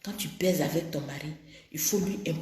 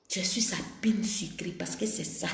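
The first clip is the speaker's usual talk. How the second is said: whisper; 6 dB quieter than usual. Real, not acted. Intimate sex advice to women.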